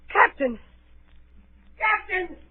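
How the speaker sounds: high, loud cries of alarm; alarmed calling. Acted. Boy calling to the fallen captain, alarmed shout. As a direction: call out urgently, pitch leaping up.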